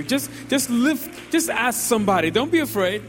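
high, loud altar call; rousing altar call over music; fervent preaching. Real, not acted. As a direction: preach with full fervor. Preaching altar call at full force.

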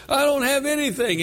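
high-pitched imitated cry of despair; anguished protest. Preacher voicing Bram at the altar, anguished protest. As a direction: wail it mildly, as the desperate seeker. Crying convert protests, retold.

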